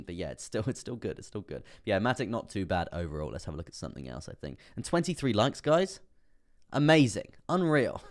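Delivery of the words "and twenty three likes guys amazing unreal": excited. Real, not acted. Thanking viewers for likes.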